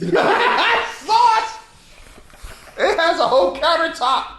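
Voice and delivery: high, loud laughing exclamation; laughing shout. Real, not acted. Laughing over the sauce-covered costume.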